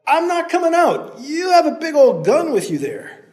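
loud, very high call. Acted. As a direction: call down from behind the trees. Hero calling down.

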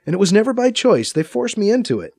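high-pitched defensive protest. A narrator's reading. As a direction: insist it in your own defense. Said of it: "B C protests".

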